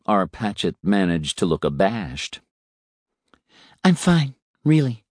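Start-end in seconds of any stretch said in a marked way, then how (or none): 3.8-5.0 high, shaky insistence; young woman's voice. A narrator's reading. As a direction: force a brave answer, higher. Repeated line, same voice.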